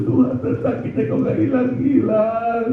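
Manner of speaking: very high, slow, sustained wail; retold pained cries. Preacher recalls screaming despite anesthesia, high drawn-out mock wail. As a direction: re-enact wailing that the pain won't stop. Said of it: "masih teriak terus".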